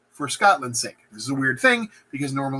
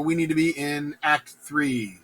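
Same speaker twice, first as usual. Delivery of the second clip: ordinary delivery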